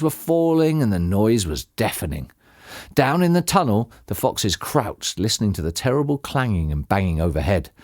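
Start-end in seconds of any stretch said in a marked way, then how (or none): none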